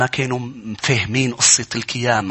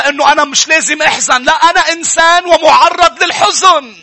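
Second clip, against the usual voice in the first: high, raised sermon voice; full-force preaching; 13 semitones higher, 6 dB louder than usual. Acted. Preacher tells the congregation of Mary at the tomb, full-force preaching.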